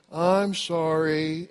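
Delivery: long, drawn-out mock-sincere sorry; insincere apology. Child told to sound sincere, drawn-out fake apology. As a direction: stretch it out like a coached child. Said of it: "Say it as if you meant it".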